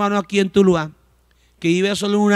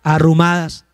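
ordinary talk, nothing marked

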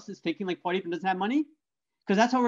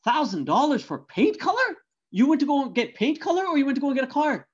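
higher, louder, disbelieving father; incredulous dad; 6 semitones higher, 3 dB louder than usual. Retold or imitated. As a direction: scold the son in sheer disbelief. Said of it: "And Dad goes".

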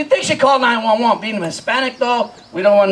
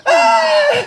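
mock wail. Mock scream of the crying.

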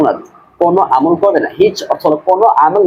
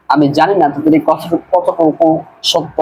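usual speaking voice

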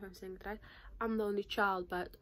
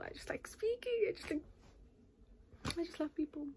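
gushing; 8 semitones higher than usual. Real, not acted. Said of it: "gushing about loving people".

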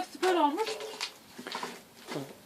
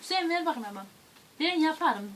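ordinary delivery

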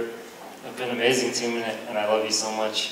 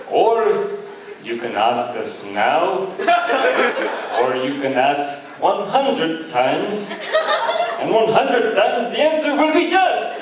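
attempted Indian accent; 7 semitones higher, slower than usual. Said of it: "I can't do an Indian accent".

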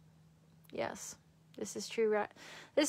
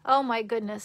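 usual speaking voice

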